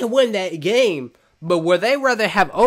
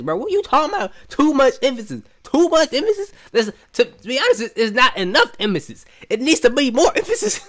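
high-pitched, indignant disbelief; incredulous; 6 semitones higher than usual. Real, not acted. Fan reacting to LeBron's "too much emphasis" remark, incredulous. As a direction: challenge it as if you cannot believe your ears.

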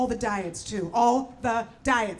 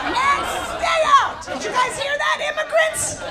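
mock yell; 13 semitones higher, 4 dB louder than usual. Mock-angry yell at immigrants.

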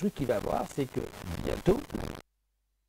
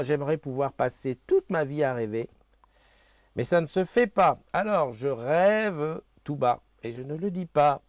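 louder, slowing right down on the middle line; poem reading; 5 semitones higher, 11 dB louder than usual. Acted. Host reads the daily poem to viewers, lilting recital. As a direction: read the little verse as a small show.

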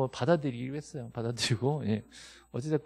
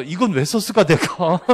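mock puzzled student; 3 semitones higher, 10 dB louder than usual. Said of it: "보고 나서".

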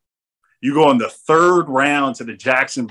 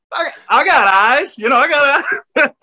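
mock jealous protest; 12 semitones higher, 4 dB louder than usual. Overlooked friend answering those who admire his buddy's eyes, mock jealous. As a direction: squeal it like a comically overlooked friend.

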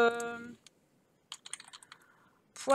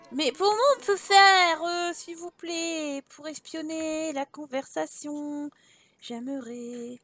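pleading character voice; 5 semitones higher, slower than usual. Pretend.

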